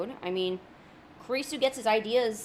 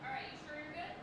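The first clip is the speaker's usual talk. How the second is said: distant, soft, off-mic talk; from another room; 4 semitones higher, 16 dB quieter than usual. Pretend. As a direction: talk as if walking away from the mic. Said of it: "someone's calling me from the other room".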